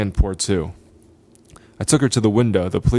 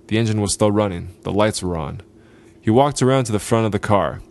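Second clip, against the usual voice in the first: ordinary delivery, nothing marked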